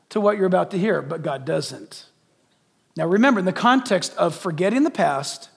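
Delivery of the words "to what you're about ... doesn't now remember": usual speaking voice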